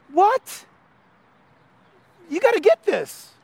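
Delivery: high, exasperated, hands on hips; exasperated angel. Retold or imitated. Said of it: "angels kind of putting their hands on their hips".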